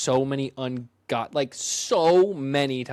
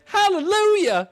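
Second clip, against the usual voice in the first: high, loud, slow Southern "Hallelujah!"; Southern preacher accent; 6 dB louder, slower than usual. Retold or imitated. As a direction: belt it like a Southern church shout. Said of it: "Santa Southern accent".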